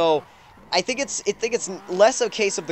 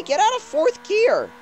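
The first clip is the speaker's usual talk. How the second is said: high, slow pleading; 12 semitones higher, slower than usual. Real, not acted. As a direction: beg the gearbox, high. Urging the car.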